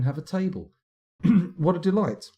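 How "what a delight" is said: wobbling voice, high and loud; unstable grainy voice. Real, not acted. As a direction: voice wobbling all over. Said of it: "my voice is wobbling all over the shop".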